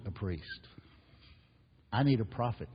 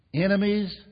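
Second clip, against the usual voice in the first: much higher and louder; preached cry; 9 semitones higher, 8 dB louder than usual. Acted. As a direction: ring out the first word. Preacher crying out a contrast.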